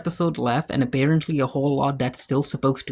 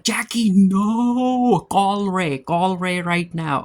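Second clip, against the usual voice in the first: alarmed warning; 5 semitones higher, much slower than usual. Retold or imitated. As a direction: shout a desperate warning at the TV. Viewer yells warning at character.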